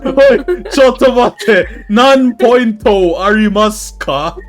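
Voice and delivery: high and loud, rushed; loud flustered. Real, not acted. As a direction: blurt it out in a panic. Panicking over guest's points.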